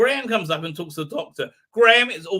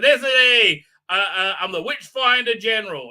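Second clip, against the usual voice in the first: loud, higher pompous self-introduction; mock grand announcement; 4 semitones higher, 7 dB louder than usual. Vlogger mimicking the Witchfinder's reveal, loud mock proclamation. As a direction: puff up and declare the title. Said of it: "pulls it out and says".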